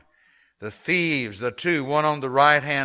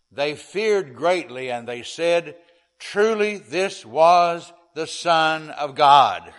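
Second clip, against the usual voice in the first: scripture reading; 3 semitones higher, 5 dB louder than usual. Acted.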